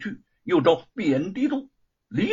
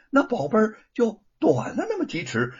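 ordinary delivery, nothing marked

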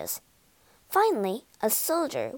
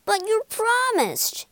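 protesting; 13 semitones higher, 4 dB louder than usual. Acted. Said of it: "they said".

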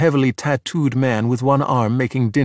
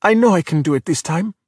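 high, earnest insistence; hopeful, higher-pitched appeal; pleading; 6 semitones higher than usual. A narrator's reading. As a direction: plead to be believed. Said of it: "Luca pleaded".

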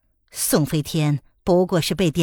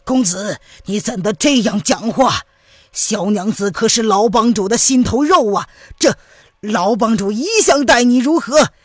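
higher, worked-up reproach, stammering on 你; agitated elder; 4 semitones higher, 4 dB louder than usual. Acted. Elder rebuking the young master over his junior sister, agitated. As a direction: plead in outrage, stumbling over the words. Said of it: "曹长老急了".

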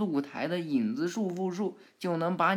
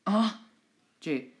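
ordinary delivery

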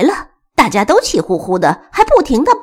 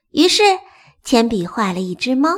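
plain reading voice

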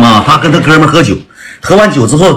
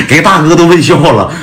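ordinary talk, nothing marked

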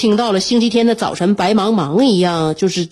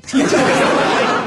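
laughing; 4 semitones higher than usual. Real, not acted.

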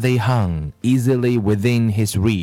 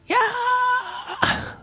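high-pitched storybook scream held at modest volume; 4 dB quieter than usual. A narrator's reading. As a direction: let out a thin, drawn-out "yaaah". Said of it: "screamed Leslie".

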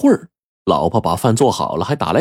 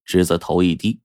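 plain reading voice

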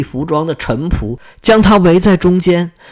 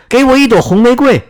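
high, raised-voice plea; calling out loud; 8 semitones higher, 4 dB louder than usual. A narrator's reading. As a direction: call out to the tree, pitched up. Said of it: "夜莺大声说".

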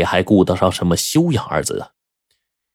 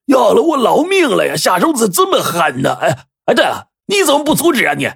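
high, strained character voice, wailing; hammed-up injured man's protest; pained outcry. A narrator's reading. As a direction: wail and complain in agony. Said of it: "马王爷大叫一声".

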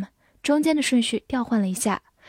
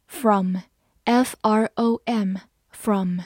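very slow letter-by-letter spelling; much slower than usual. Shown as a demonstration. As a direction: spell from out slowly. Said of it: "spelling a word slowly". (极慢拼读)

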